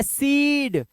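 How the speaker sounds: सीड with the ee held long; slow, drawn-out सीड; long-vowel demo. Shown as a demonstration. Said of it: "अब कैसे पढ़ेंगे".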